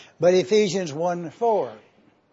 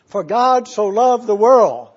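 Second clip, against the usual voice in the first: much louder on the verse; emphatic scripture quote; 8 dB louder than usual. Acted. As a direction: proclaim it forcefully as a correction. Corrects misquote with the true verse.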